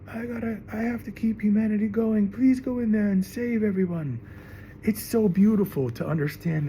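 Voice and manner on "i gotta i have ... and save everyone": mock pleading woman. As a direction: beg the men sweetly. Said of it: "She's like".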